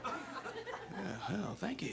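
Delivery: very soft, under-the-breath reply; sheepish thanks. Acted. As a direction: accept the compliment barely audibly. Sheepish reply to faint praise.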